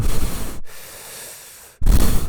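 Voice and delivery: loud wheezing exhale; heavy loud sighing breath. Shown as a demonstration. Showing how he breathed at three hundred pounds, wheezing. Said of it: "that's how I would breathe normally".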